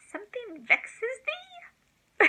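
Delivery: high, slow, archaic movie-character voice; hammed-up old-fashioned question; quoted movie line. Grandma quoting a favourite movie to the child, high and slow. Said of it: "it's a quote from one of my movies".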